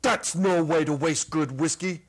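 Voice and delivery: loud, disapproving big-man voice; frowning giant. Acted. Sobered Ha Ha scolding the kids about wasting whiskey, loud and stern. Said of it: "he frowns".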